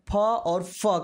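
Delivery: first "फ" as pha, second "फ" as fa; ph then f. Shown as a demonstration. Teacher summing up for learners, "फ" as pha, then "फ" as fa. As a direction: contrast puffed "फ" with teeth-on-lip "फ". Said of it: "का उच्चारण करते हैं".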